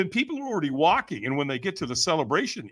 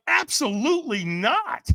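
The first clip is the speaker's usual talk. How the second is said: drawn-out, high, emphatic refusal; mock indignant, warning him away; back-off refusal; 10 semitones higher, slower than usual. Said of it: "like, "Back off, man".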